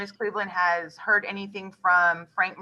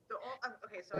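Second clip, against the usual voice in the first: very faint voice; faint, far from the mic; barely audible; 3 semitones higher, 14 dB quieter, faster than usual. Real, not acted. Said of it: "your voice is very faint".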